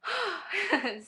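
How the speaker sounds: high, audible sigh; emotional sigh of delight; happy sigh. Real, not acted. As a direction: let out a big high-pitched sigh. Overcome after showing baby picture.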